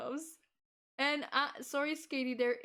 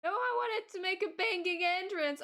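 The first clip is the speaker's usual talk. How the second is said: playful protest; 9 semitones higher, 4 dB louder than usual. Pretend. Mock protest at teammate.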